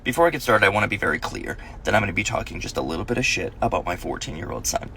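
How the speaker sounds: ordinary delivery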